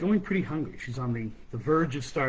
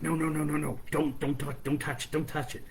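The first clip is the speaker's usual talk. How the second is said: rapid repeated urging; urgent warning; 3 semitones higher than usual. Acted. Mouse stops starving girl touching the food, urgent. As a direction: stop them with quick, insistent repeats.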